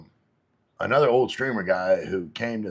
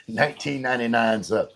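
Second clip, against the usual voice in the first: ordinary delivery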